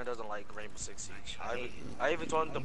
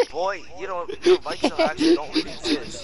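very high, loud squeak; squeaky mock voice; 9 dB louder than usual. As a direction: pitch way up in a silly voice.

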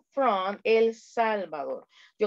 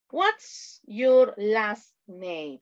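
very slow, each word drawn out; slow modelling; much slower than usual. Shown as a demonstration. Teacher models question for learners. (modelo muy lento, palabra por palabra)

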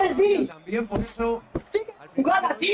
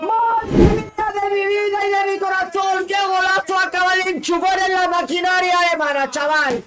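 breakneck goal commentary; 5 semitones higher than usual. Real, not acted. Fan celebrating a goal.

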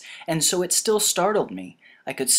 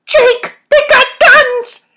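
very high, loud, stretched-out pleading; high-pitched alarmed cry; urgent character warning; 17 dB louder than usual. Acted. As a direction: warn him urgently at the top of the range. Voices Bella warning Jake urgently.

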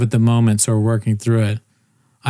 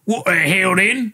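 slow, higher-pitched mock British outrage; British commander; 7 semitones higher than usual. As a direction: draw it out as an indignant British officer. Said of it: "the British commander, he was like".

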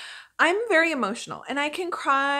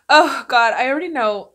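blurts it out, already bracing to cry; sudden dread; 2 semitones higher, 10 dB louder than usual. Real, not acted. Vlogger about to watch sad ads, loud bracing outburst.

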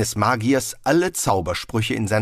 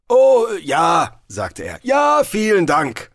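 overdone thanks; 9 semitones higher, 6 dB louder, slower than usual. A narrator's reading. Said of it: "fügte er höflich hinzu".

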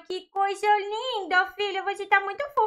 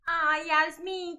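ordinary talk

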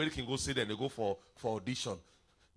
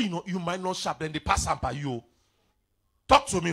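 ordinary talk, nothing marked

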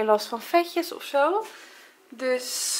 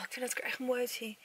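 ordinary delivery

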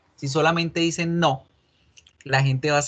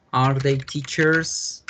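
slow model question; much slower than usual. Shown as a demonstration. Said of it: "teacher models the question slowly".

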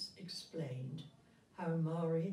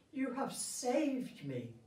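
grateful prince; 7 semitones higher, slower than usual. Acted. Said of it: "he said".

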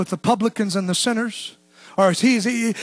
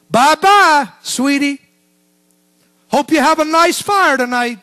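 syrupy fake-sweet send-off, bye-bye punched louder; sarcastic mock farewell; 3 semitones higher, 5 dB louder than usual. Preacher mocking Christians who condemn sinners, fake sweet. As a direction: sneer it like a smug street preacher. Mocking judgmental street witnessing.

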